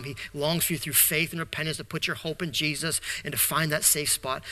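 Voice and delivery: usual speaking voice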